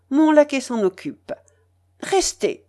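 high, commanding lady's reply; imperative. A narrator's reading. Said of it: "d'une voix impérative". (voix impérative, aiguë)

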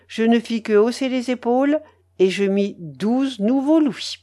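usual speaking voice

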